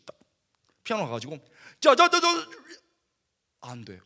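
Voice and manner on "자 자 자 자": high, loud eager count-in; mock eager start. Cocky student starting the hard piece, loud mock eagerness. Student trying to play piano.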